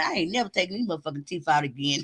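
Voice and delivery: low, soft and quick through laughter; talking to herself in the bathroom, giggling; muttering to herself, laughing. Retold or imitated. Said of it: "he heard me saying".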